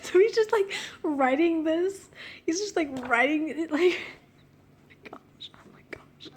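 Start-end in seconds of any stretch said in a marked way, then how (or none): 4.7-6.3 unvoiced panicky whisper.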